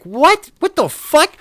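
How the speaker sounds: high, loud squawk of disbelief; incredulous outburst. Retold or imitated. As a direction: shoot way up in pitch, outraged and stunned. Retelling his disbelief at boss.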